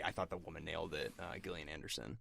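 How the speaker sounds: usual speaking voice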